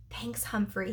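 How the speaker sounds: soft, hushed gratitude; whispered thanks. Acted. Garth thanking Humphrey secretly on the bus, whispered. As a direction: breathe the thanks quietly. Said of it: "he whispered".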